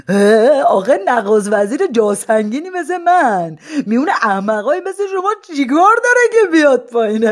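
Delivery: high, loud teasing lunatic; mocking madman. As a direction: taunt the crowd from the rooftop. Madman mocks crowd from roof.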